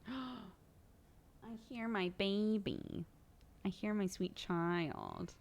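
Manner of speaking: soft, slow doting murmur; tender parent. Real, not acted. Parent hearing their child nearby, tender and slow. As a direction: draw it out softly and fondly.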